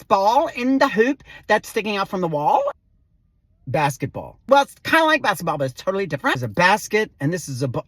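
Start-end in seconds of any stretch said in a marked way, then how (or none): 3.7-4.4 low deadpan one-word answer; name it dryly, pitched low. Acted. Dry naming of the exercise. 4.5-6.4 put-on hurried Valley Girl explaining. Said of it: "like, a Valley Girl thing".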